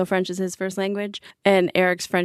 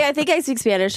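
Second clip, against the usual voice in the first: naive confidence; 7 semitones higher than usual. Acted. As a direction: say it high, proud of your skill. Acts confidence, then deflated self-correction.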